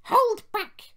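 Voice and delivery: high-pitched, loud stage whisper; squeaky plotting coach; conspiratorial. A narrator's reading. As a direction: pass on a secret order in a squeaky voice. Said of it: "whispered Ruth to Jemima".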